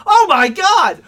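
loud, high outraged cry; furious oh my God; exasperated shout. Real, not acted. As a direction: yell it in disbelief. Outburst at the record.

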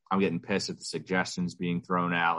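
usual speaking voice

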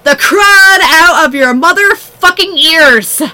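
slow, drawn-out rant; slow emphatic. Real, not acted. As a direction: spell it out slowly in disgust.

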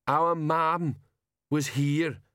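put-on slow, high, childlike big man; simple giant speaking slowly; Banjo voice. A narrator's reading.

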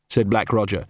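ordinary delivery

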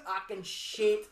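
ordinary talk

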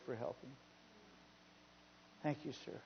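very quiet, low imitation of the boy; soft retold thanks. Little boy thanks the man for helping, hushed. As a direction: voice a child's hushed gratitude.

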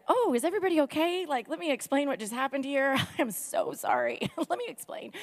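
high, fretful smoothing-over with nervous laughs; codependent apologizing. Acted. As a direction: play an embarrassed companion excusing a friend. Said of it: "I'm very codependent, so I would just be like".

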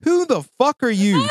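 high, loud, indignant shouting; hammed-up furious questioning; mock outrage. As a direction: demand to know who they are. Ranting at imagined person.

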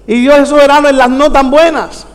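ordinary delivery

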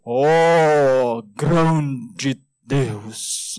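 lightly hammed-up pious praying tone; a touch of solemn churchy prayer voice; mock prayer voice. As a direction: imitate someone changing their voice to pray. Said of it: "elas mudam até a entonação da sua voz".

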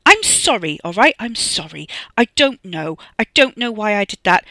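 loud, exasperated pleading; yelled apology. A narrator's reading. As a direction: burst out defensively, then plead. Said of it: "Wes yelled at the phone".

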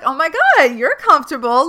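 gushing nurse. Retold or imitated. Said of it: "She was like".

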